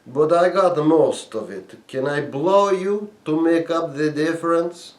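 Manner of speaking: put-on Russian accent. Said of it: "she said".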